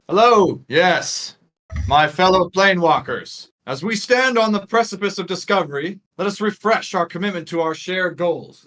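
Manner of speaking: loud, high oratory to a crowd; rally the troops from a podium, projecting; political speech. Acted. Leader addressing fellow planewalkers, rallying political speech. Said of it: "like, a somewhat of a political speech".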